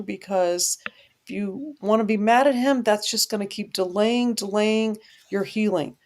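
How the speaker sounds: ordinary talk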